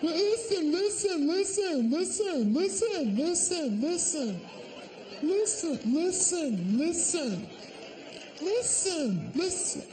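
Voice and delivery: hammed-up whiny nag; nagging woman. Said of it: "listening to that".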